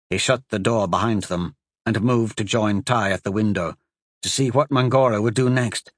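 ordinary delivery

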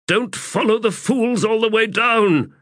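very high, urgent plea; pleading. A narrator's reading. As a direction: beg them to hold back, voice pushed high. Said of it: "Ericala pleaded".

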